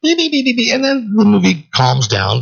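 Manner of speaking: drawn-out high slow line; a slow, lifted sing-song drift. Acted. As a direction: stretch it like the film fading down. Mock movie soundtrack calming.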